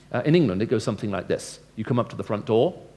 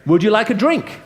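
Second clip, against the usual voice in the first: bright hostly offer; 11 semitones higher, 9 dB louder than usual. Shown as a demonstration. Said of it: "Then you say".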